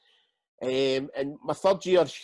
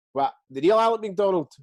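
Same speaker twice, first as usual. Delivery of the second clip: loud, high disbelieving question; retold astonished outburst; disbelief; 4 semitones higher, 5 dB louder than usual. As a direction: blurt it out, stunned it's really him. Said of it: "And I was like".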